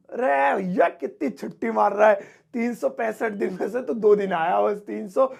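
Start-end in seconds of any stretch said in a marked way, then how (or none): none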